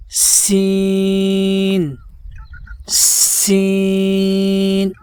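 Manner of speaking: "سین" drawn out very long with a whistling hiss. Shown as a demonstration. Teacher showing the whistle: a long, hissing "سین". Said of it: "سیٹی کی آواز پیدا کی جائے گی".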